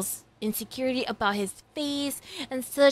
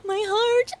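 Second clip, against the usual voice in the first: high giddy gushing with laughter. Real, not acted. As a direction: gush and squeal over the episode. Gushing over episode.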